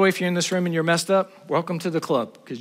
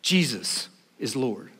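quiet solemn; 6 semitones lower, 6 dB quieter, slower than usual. Shown as a demonstration. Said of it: "There's no law system that is Lord".

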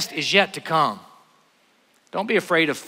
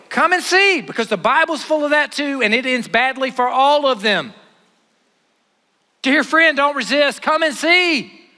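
preaching at full force; 8 semitones higher, 5 dB louder than usual. Acted. Preacher urging his friends not to resist, at full force. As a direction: ring it out to the back row.

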